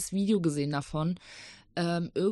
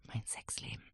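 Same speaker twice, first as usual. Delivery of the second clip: whispering; 15 dB quieter than usual. Real, not acted. Whispered joke aside.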